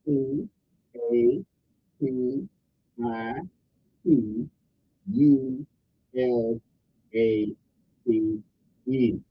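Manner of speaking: one letter at a time, with a pause after each. Shown as a demonstration. Host spelling the word of the day slowly for listeners. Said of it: "I'll say it slower".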